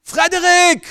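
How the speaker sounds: loud, high shout of the son's name; furious father calling his son; drunk father's yell. Acted. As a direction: bellow the boy's name across the yard. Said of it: "schreit er".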